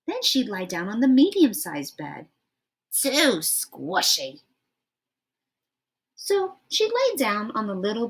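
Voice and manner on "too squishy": slow complaint. Acted. Goldilocks tests the medium bed.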